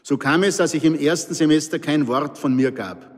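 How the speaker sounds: plain reading voice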